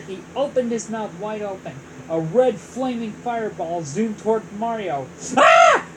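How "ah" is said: high, loud startled yelp; startled cry. Acted. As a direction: yelp in surprise. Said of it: "Mario cried".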